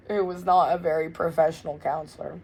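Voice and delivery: normal talking voice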